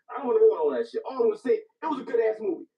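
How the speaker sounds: loud, hyped, can't hold it in; raving about a great film at full volume; excited outburst. Real, not acted. Friend raving to friend about the movie, loud and excited.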